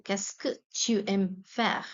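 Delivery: slow, careful repeat of "Qu'est-ce que tu aimes faire?"; unhurried classroom repetition. Shown as a demonstration. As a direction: spell the question out for learners. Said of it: "teacher repeats question slowly".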